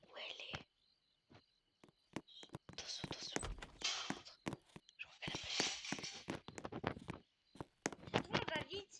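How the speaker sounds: hushed, breathy hiding whisper; whispering while hiding. Real, not acted. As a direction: whisper so she won't hear. Hiding from the granny. (chuchoté en se cachant)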